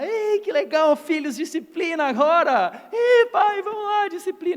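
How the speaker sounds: mock cheerful parents. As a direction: overact gleeful parents calling to discipline. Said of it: "Ninguém vai disciplinar os filhos na alegria". (pais fingindo alegria, bem mais agudo e alto)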